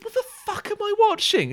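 very high, incredulous squeal; exasperated outburst. Retold or imitated. Viewer interrupting his impression of the host, exasperated high squeal. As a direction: squeal it in baffled disbelief.

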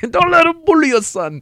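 high, loud imitation of a fight coach; corner man impression. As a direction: holler it like a cornerman firing up his fighter. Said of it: "I just remember that quote".

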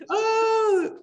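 high, loud tearful cry. Real, not acted. Guest reacting to her sister crying, tearful. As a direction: sob out a single moved oh.

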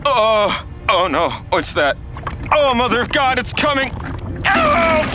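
high terrified yelling; panicked screaming. Acted. Panics at incoming blast.

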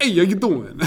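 hammed-up Brooklyn accent guy greeting. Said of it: "I used to try to do just a Brooklyn accent".

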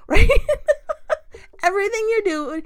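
a loud, high laugh on right; a real burst of laughter. Real, not acted. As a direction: laugh and ask for agreement. Laughs at everything making it worse.

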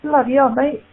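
high hammed-up London exclaim; mock British amazement. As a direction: marvel like an impressed boxer. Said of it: "And he goes".

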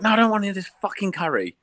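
wife's snarl, done high-pitched; hammed-up angry wife in labour, voiced high; mock furious wife. Husband imitates his wife snapping from the bath, mock furious. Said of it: "She goes".

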